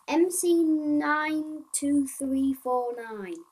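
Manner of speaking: slow, loud bug-list read-out; reading aloud. Acted. Player reading bug numbers and fixes to viewers, reading aloud. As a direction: read each item out loudly, drawing it out.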